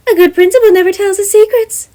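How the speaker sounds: high, bright-voiced principal; Nezu voice. Nezu asking Gem about housing, high character voice. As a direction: lift the pitch way up for the chimera principal.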